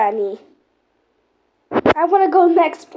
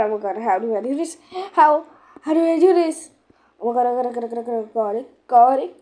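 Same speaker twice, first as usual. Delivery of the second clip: ordinary talk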